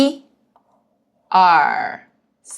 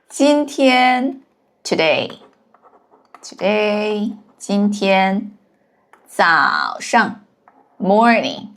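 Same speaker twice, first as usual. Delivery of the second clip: slow glossing; slower than usual. Shown as a demonstration. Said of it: "teacher glosses words slowly".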